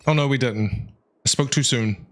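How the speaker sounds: normal talking voice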